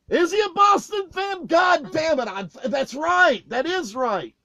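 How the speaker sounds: yelled high and loud, then settling; mock angry shout. Singer cussing out the stage hand, shouting. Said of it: "a predominant singer in Charleston who cussed me out".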